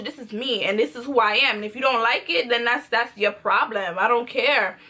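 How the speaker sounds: normal talking voice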